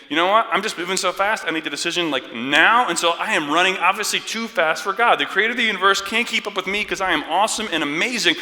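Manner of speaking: loud, high, hurried boasting; mock self-importance. Busy believer bragging of outrunning God, mocking loud rant. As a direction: puff yourself up, breathlessly frantic.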